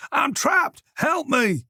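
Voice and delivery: high cry, trapped below; shouting for help. A narrator's reading. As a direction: call out desperately from the cellar. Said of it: "Mason shouted from below".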